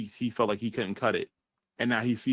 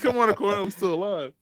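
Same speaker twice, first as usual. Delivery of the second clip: higher, louder mock shout for help; mock survivor call; 8 semitones higher, 4 dB louder, faster than usual. A survivor yelling at the heroes, higher mock call. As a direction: call out for rescue, hammed up.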